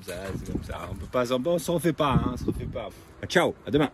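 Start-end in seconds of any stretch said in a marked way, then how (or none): none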